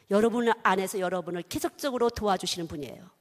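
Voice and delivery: normal talking voice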